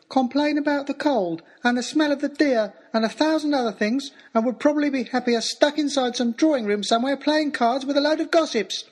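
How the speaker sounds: put-on high, sulky boy's voice; lightly mocking a cross young lad; bad-tempered boy. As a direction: mimic the lad's grumpy complaint. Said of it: "the lad turned suddenly bad-tempered and said rather fiercely".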